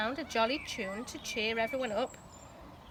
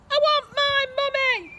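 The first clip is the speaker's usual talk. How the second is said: put-on bellowing baby; 10 dB louder than usual. A narrator's reading. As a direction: bawl it like a furious toddler. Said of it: "bellowed the baby".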